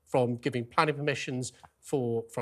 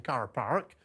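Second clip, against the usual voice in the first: Lancashire "Car Park" with both Rs sounded; Lancashire accent; 3 semitones lower, 5 dB quieter than usual. Shown as a demonstration. Host urging Britain to copy Lancashire, rhotic Lancashire accent. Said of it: "they should all be saying".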